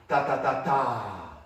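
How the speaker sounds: loud tapped ta syllables; teacher modelling a rhythm; rhythm demo. Shown as a demonstration. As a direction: beat out ta ta ta ta for the class. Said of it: "And watch how this works".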